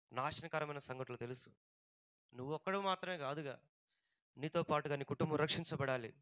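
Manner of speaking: soft, low-pitched pastoral appeal; quiet, earnest exhortation; hushed preaching. Real, not acted. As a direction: bring the voice down and urge them quietly.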